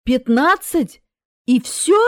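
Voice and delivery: loud, incredulous questions; изумление. Acted. Death stunned by the tiny number. (громко, не веря ушам)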